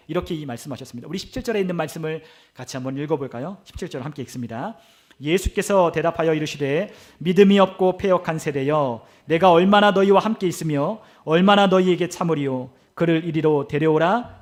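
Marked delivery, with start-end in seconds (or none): 5.2-14.4 loud, measured congregational Bible reading; slow, forceful recitation of scripture. Acted. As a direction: proclaim the verse slowly and firmly. Reads the verse aloud.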